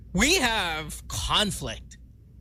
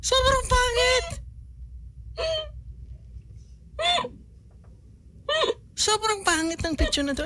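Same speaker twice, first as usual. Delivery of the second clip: horrified disbelief. Real, not acted. Horrified at his own photo.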